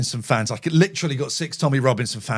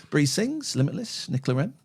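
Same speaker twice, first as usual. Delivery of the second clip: ordinary delivery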